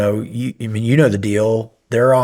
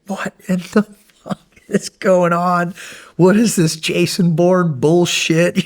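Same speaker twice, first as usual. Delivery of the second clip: retold screaming; 7 semitones higher than usual. Instructor yelling at a case officer who keeps missing, furious shout. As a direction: blow up at her for missing again. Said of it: "I'm screaming at this poor".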